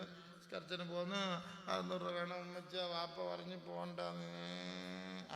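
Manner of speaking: put-on soft, slow whimpering child voice, a touch of sobbing; crying child. A child tearfully tells his mother, soft slow whimper. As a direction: play the hurt little boy, drawn out and soft.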